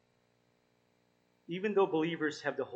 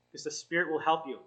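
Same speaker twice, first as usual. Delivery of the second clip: ordinary talk, nothing marked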